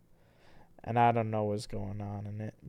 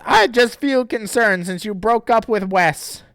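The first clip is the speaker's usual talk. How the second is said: character voice; 10 semitones higher, 10 dB louder than usual. Acted. Reading Chris's line.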